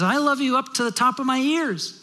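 put-on high, eager bunny child voice; little kid rabbit. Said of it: "the little kid rabbit says to his dad".

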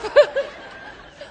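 one last laugh; real trailing laughter; breathy laugh. Real, not acted. Continued laughter after joke.

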